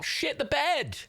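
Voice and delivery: high excited burst; high, quick "Shit the bed"; excited exclaim. Real, not acted. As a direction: blurt it out in delight. Reacting to a gifted sub.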